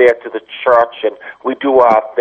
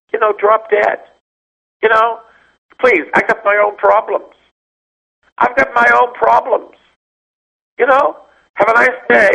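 much higher sing-song brush-off voice; mock dismissive priest; 12 semitones higher than usual. Priest mocking a colleague who walked away, high mock dismissal. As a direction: pitch it way up, airy and dismissive, mocking him.

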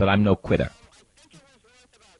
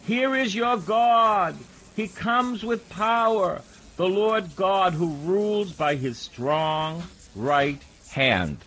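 high, slow, solemn reading voice; scripture reading; 10 semitones higher, slower than usual. Acted. Preacher proclaiming Isaiah to the congregation, solemn and slow. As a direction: lift the verses up slowly and grandly.

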